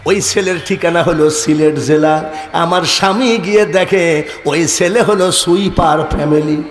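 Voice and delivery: ordinary talk, nothing marked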